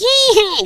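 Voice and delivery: very high imitated squeal. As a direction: mimic a rapper's high signature squeal. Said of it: "Jekyll qui fait".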